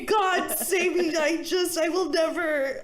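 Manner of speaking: high, loud, slow begging prayer; hammed-up drunken crying to God; mock tearful pleading. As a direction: wail and plead, dragging out the words. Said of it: "And I'm crying".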